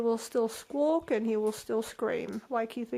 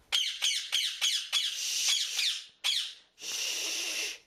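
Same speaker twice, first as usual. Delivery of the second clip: hushing. Real, not acted. Owner shushing her noisy bird. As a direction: hush the bird.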